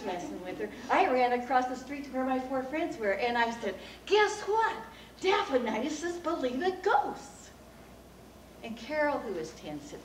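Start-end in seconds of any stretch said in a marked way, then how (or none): 4.1-7.4 high, loud and excited; excited kid spilling a secret; excited news. Retold or imitated. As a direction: burst out with big news to friends. Child rushes to tell friends news.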